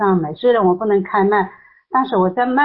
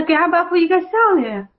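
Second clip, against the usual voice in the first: retold joyful invitation; 7 semitones higher than usual. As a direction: re-enact an uplifting invitation, pitched well up. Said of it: "我听到那个声音我就好喜乐". (高声转述喜乐的邀请)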